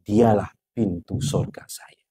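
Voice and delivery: slow breathy whisper, voice dropped; a soft, slow whisper of devotion; hushed whisper. Acted. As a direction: whisper it like a sacred vow. Emotional quote of devoted son.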